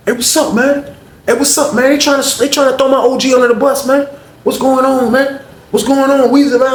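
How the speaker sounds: put-on high excited young guy voice; young boy calling out. Young boy hailing Weezy, high and eager.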